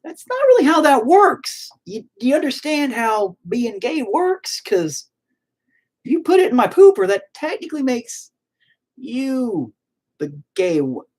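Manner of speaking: high, mock-patient explaining; high, sarcastic retort to the bully; sarcastic lecture. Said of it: "And I'm all".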